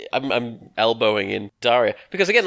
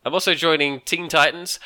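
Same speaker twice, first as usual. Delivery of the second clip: normal talking voice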